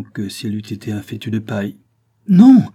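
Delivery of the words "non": high, very loud yell; screamed refusal. A narrator's reading. Sonia as police push past her, screamed. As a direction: scream it out in protest. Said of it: "hurla-t-elle".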